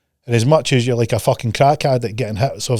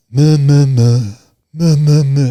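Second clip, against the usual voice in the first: louder bleating meh; mock moaning; 5 dB louder, slower than usual. Coach imitating clients moaning about results. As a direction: bleat like a bored complainer. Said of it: "it sounds like this".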